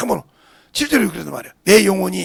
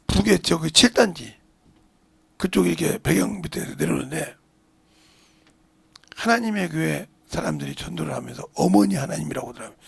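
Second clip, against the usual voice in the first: ordinary talk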